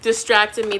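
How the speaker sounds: ordinary talk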